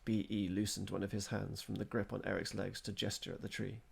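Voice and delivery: ordinary delivery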